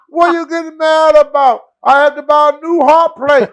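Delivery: high, loud, indignant defense; loud high protest. Retold or imitated. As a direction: protest loudly and high, all innocence. Nikolai defends himself to angry Sheik.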